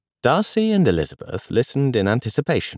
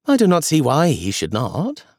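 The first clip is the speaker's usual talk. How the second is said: lightly raised, amused granny voice; a hint of grandmother Elizabeth; 7 semitones higher than usual. A narrator's reading. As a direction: play the fond grandmother lightly. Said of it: "she said".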